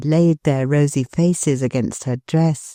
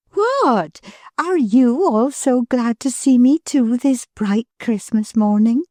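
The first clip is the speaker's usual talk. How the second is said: higher, delighted surprise; pleasant wonder; 7 semitones higher than usual. Acted. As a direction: gasp with happy wonder. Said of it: "clapping her hands in a pleasant wonder".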